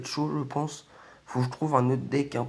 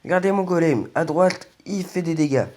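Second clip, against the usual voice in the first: excited; 5 semitones higher, 7 dB louder than usual. Real, not acted. Excited by golem damage.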